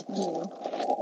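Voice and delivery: muffled, lying on earbuds. Real, not acted. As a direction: talk muffled into the pillow. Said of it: "You sound muffled".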